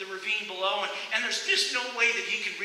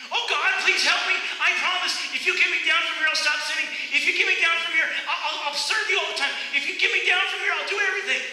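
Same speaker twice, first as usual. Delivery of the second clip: high, loud begging that climbs higher with each promise; desperate pleading, building; 9 semitones higher, 6 dB louder, faster than usual. Acted. Jack dangling from the branch begs God, desperate pleading. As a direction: bargain for your life, faster and faster.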